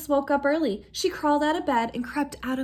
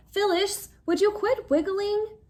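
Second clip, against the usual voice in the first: complaining; 8 semitones higher than usual. A narrator's reading. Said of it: "complained Phil Junior".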